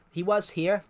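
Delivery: ordinary delivery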